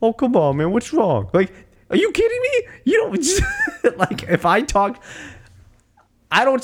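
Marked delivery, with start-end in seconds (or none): 0.0-4.0 very high mock protest, ending in laughter; Rocky imitation. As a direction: imitate a dad pleading in disbelief. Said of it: "Rocky's just like".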